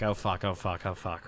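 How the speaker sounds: low, soft panicked muttering; hushed, low imitation of inner panic; muttered dread. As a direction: mutter it under your breath. Acting out his dread as she nears.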